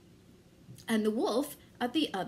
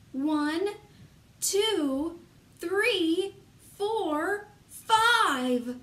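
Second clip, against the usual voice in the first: building count; 6 semitones higher, 4 dB louder, much slower than usual. Acted.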